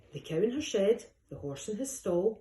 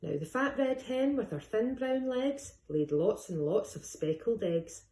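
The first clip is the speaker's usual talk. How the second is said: ordinary delivery, nothing marked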